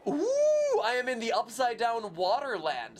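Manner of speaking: ordinary delivery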